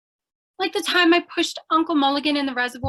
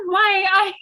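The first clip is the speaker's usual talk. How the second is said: nervous laugh; 6 semitones higher than usual. Acted. Nervous about the murder talk.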